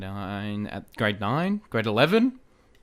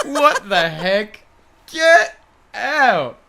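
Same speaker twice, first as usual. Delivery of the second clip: very high, loud, drawn-out exclamation; stunned disbelief; 10 semitones higher, 7 dB louder, much slower than usual. Retold or imitated. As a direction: squeal it in utter astonishment. Retells shock at lookalike compliment.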